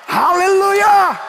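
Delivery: long, very high, loud drawn-out Aleluia; shouted Aleluia. Real, not acted. Pastor exalting before the church, a long loud shout. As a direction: cry it out in triumph, held long.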